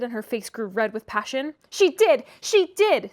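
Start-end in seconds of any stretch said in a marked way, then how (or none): 1.7-3.1 put-on high, loud childish chant; singsong taunt. A narrator's reading. Linton taunting Catherine in a high singsong. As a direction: gloat in a sing-song. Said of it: "sang Linton".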